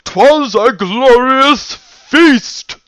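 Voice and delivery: booming, high, drawn-out royal proclamation; slow, very loud, high-pitched declaration; Princess Luna. Acted. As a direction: shout it grandly, stretching every word. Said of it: "As Princess Luna might say".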